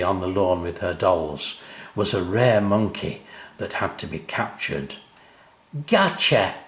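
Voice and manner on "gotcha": triumphant cry. A narrator's reading. Sam pouncing on his sister, gleeful cry. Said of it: "he cried as he pounced".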